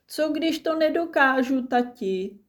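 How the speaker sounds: put-on high, anxious child's question; worried child. A narrator's reading. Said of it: "zašeptal".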